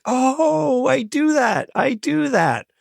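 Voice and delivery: mock dawning shock at his own habit; sudden realization. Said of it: "And I'm like".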